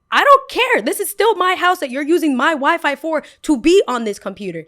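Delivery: very high, loud angry yell; furious yell. Retold or imitated. Girl yelling at a rude boy using her Wi-Fi, very high loud furious yell. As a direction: shout him down.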